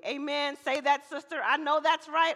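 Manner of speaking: playful amen-corner response; church talk back. Shown as a demonstration. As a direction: shout it back from the pews. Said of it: "All that kind of talk back".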